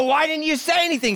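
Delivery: high, loud mock-exasperated whine at his wife; exasperated question. Husband mock-whining at wife after missing his turn, exasperated. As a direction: whine it up high like she let you miss the turn.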